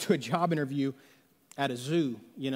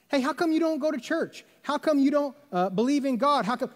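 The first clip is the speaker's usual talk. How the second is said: higher-pitched, lightly acted challenge; mock questioning; 7 semitones higher than usual. Asking someone why they skip church, lightly acted questioning.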